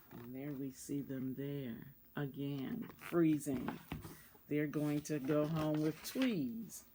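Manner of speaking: low, hushed picture aside; soft murmured aside to the listeners; quiet aside. Real, not acted. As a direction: drop the voice to point at the page.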